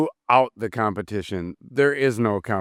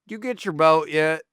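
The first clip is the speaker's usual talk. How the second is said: mock Southern accent; 4 semitones higher than usual. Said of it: "Down south, like".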